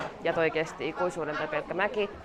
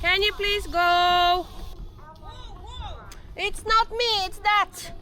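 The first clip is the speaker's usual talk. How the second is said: much higher, slow exasperated plea; high pleading; 13 semitones higher, much slower than usual. Real, not acted. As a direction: beg them to move. Pleading with slow rider ahead. (korkea anova vetoomus)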